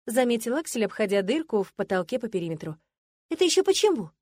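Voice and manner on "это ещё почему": high, outraged question; indignant. A narrator's reading. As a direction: bristle at the insult. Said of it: "возмутилась я".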